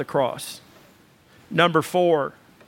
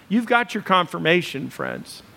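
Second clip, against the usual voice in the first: ordinary talk, nothing marked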